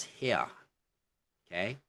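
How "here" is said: "here" as "hee-uh"; "here" with no R, gliding to uh; British HERE. Shown as a demonstration. Teacher shows how the word is pronounced in British, non-rhotic "here". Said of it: "pronounced as".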